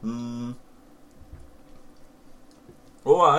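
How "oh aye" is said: surprised. Real, not acted. Tasting cider, finds whiskey flavour.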